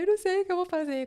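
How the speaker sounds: high, loud, fast crying words; breaking into tears, voice high and pushed. Retold or imitated. Telling her boss she's quitting, crying. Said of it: "Aí eu comecei a chorar".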